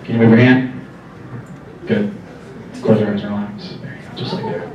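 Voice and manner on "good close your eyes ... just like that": soft spa whisper. Acted. Calming hypnotized volunteer.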